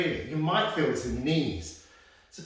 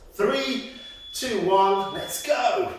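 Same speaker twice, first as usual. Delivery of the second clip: loud countdown; 5 semitones higher, 5 dB louder, much slower than usual. Real, not acted. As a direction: shout the count, rising hype. Instructor counting class in.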